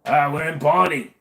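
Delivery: put-on high, loud overdone Cockney accent; hammed-up bad Cockney accent; Don Cheadle's bad Cockney. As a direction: mangle a Cockney accent on purpose. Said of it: "His was so bad".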